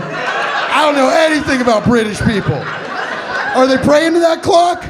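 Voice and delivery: high, loud baffled yell; incredulous shout. Acted. Comedian baffled by clock-praying British people, incredulous shout. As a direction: shout the question in mock bewilderment.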